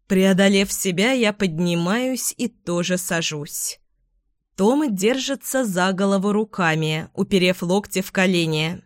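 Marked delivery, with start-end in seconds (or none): none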